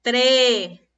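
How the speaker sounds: "tre" loud and long; stressed syllable. Shown as a demonstration. Teacher names the tonic syllable, "tre" loud and held. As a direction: punch and hold "tre". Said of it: "la sílaba tónica es".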